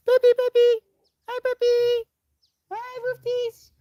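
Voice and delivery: loud squeaky falsetto greeting; high-pitched baby voice. Shown as a demonstration. Demonstrating pet talk to the puppy, squeaky high voice. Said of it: "Let me show you the behavior".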